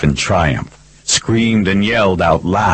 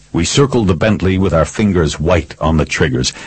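ordinary delivery, nothing marked

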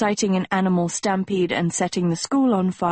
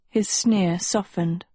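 ordinary delivery

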